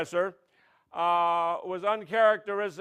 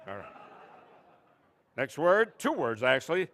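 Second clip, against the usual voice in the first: ordinary talk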